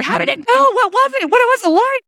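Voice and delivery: very high, loud excited squeal; eager questioning. Retold or imitated. Family member calling straight after the good deed, eagerly questioning. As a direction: imitate someone bursting to hear everything.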